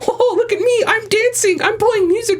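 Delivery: high, silly, laughing mock voice; carefree goofy player. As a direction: play a carefree show-off. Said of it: "every squad match is people".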